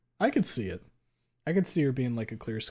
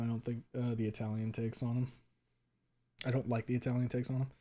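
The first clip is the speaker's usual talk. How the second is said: ordinary delivery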